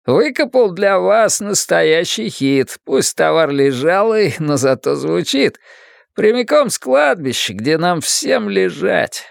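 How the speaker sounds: hammy late-night DJ, crackly speaker; cracked radio voice. Acted. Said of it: "надтреснутый голос из радиоприёмника".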